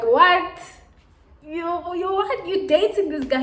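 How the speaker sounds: high, loud shocked outburst; shocked disbelief. Retold or imitated. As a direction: blurt it out in total shock. Shocked at friend's news.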